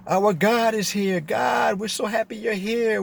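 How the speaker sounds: high, drawn-out imitation of a worshipful crowd; mock adoring crowd. Voicing an adoring crowd greeting God.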